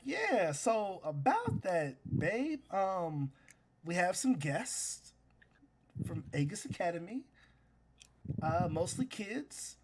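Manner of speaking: hesitant husband easing into a confession; sheepish hedging. Acted. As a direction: break awkward news to your partner, stretching the words. Scanlan breaks news of guests.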